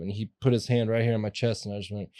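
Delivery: usual speaking voice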